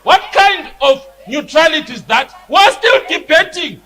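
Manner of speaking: angry shouting. Real, not acted. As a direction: demand it hotly, hammering withdraw. Member demands the Speaker withdraw.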